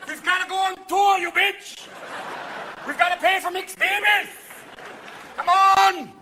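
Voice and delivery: put-on high, hollering old Swiss man; excited old man yelling high. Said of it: "Some old Swiss man's going".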